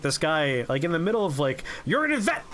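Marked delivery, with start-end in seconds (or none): 1.9-2.4 a jump much higher in pitch, cut off mid-word; resuming the rant. Retold or imitated. Boss picks the rant straight back up after the interruption.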